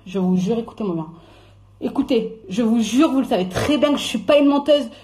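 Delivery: measured revelation with heavy pauses; solemn oath. Real, not acted. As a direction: swear it gravely, taking your time. Solemn oath before revealing her scoop.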